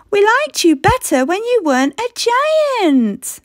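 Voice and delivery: lightly voiced high child; children complaining. Children complain to giant Ellie, lightly high child voice. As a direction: grumble like a disappointed kid. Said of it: "cried the children".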